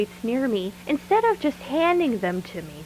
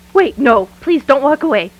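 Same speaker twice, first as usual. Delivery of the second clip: louder, desperate call after someone leaving; pleading call; 4 semitones higher, 10 dB louder than usual. Acted. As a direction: beg him to come back, loudly. Calling after Gordon as he leaves.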